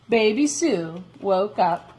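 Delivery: ordinary delivery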